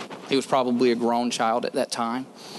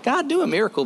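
much higher, mock pleading cry to God; 11 semitones higher, faster than usual. The preacher mocks a believer who won't step out: high mock plea. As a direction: whine it up high like a needy believer.

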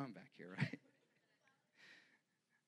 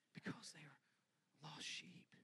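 sudden whisper; 4 semitones higher, 10 dB quieter than usual. Acted. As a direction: drop to an unvoiced whisper. Jesus answering the Father.

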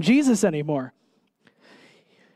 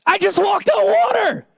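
much higher, louder, indignant protest; indignant Peter; 11 semitones higher, 3 dB louder than usual. Retold or imitated. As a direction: play Peter offended at being called faithless. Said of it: "Peter's sitting there like".

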